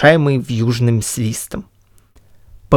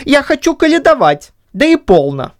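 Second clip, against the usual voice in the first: higher put-on voice, mock hurt; raised, feigned indignation; same disguised voice; 12 semitones higher than usual. A narrator's reading. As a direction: Chub still faking, higher. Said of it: "произнёс он тем же голосом".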